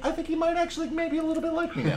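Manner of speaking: very high, soft little voice; mock hopeful. Telling himself the man might like him, high mock-hopeful voice.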